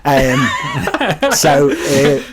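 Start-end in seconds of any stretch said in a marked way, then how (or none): 0.5-1.3 a very high, breathy squeal of a laugh; tiny high laugh; high giggle. Real, not acted. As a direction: let a squeaky giggle escape. Laughs after Jess's "No.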